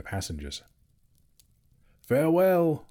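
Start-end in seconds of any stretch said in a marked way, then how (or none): 2.0-2.9 high ringing farewell call. A narrator's reading. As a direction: cry it out high and loud. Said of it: "they cried".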